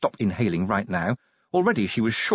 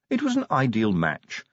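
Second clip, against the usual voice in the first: ordinary delivery, nothing marked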